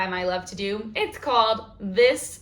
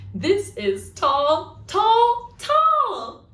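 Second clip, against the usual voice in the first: each tall climbs higher in pitch; rising tall; 10 semitones higher, 4 dB louder, much slower than usual. Shown as a demonstration. Librarian showing tall to children, climbing high. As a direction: stretch the voice upward on tall. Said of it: "Should we try that one one more time".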